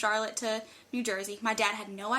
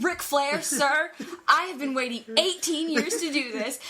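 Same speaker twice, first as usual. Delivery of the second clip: retold emphatic announcement, pitched up; dramatic declaration; 4 semitones higher, 4 dB louder than usual. Fan about to woo for her idol, retold dramatic declaration. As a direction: declare it grandly to your idol. Retelling announcement before wooing.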